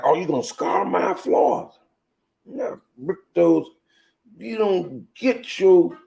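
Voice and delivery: high, loud exasperated scolding; mock scolding.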